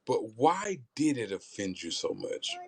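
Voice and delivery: usual speaking voice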